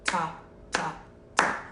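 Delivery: four separate, crisp "ta" syllables; quarter-note counting. Shown as a demonstration. Teacher contrasts quarter notes with whole note, separately struck counting. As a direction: give every beat its own "ta". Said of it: "four quarter notes would go".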